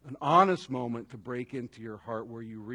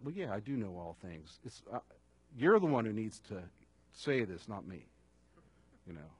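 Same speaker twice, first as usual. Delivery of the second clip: soft gentle Jesus; 4 semitones lower, 6 dB quieter than usual. Acted. Jesus answering softly.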